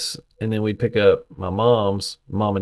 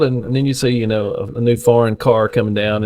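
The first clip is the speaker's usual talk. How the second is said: ordinary talk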